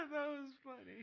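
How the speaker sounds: high wheezing laughter that fades; crying with laughter. Real, not acted. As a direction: laugh until it almost sounds like crying.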